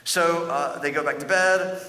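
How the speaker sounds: ordinary delivery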